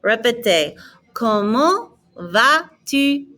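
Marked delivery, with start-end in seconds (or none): 1.1-3.3 slow, raised "¿Cómo va tu?", silent T and S; silent final letters. Shown as a demonstration. Teacher has learners repeat, slow with silent endings. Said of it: "I'm not pronouncing the S".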